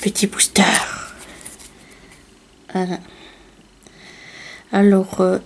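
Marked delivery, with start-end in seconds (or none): 0.0-3.0 whispered aside. Real, not acted. Announcing the little booster to viewers, whispered. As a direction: breathe it out quietly.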